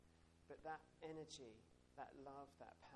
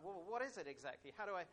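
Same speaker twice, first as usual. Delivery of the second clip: puzzled asker; 4 semitones higher, 6 dB louder than usual. Retold or imitated. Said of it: "and you're like".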